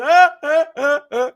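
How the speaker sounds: imitating a famous comedian's high staccato laugh; hammed-up high loud ah-ah laugh; Eddie Murphy laugh. Comic imitating the star laughing at the back of the club, high loud laugh. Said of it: "I hear Eddie Murphy's laugh".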